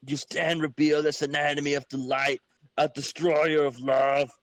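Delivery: ordinary delivery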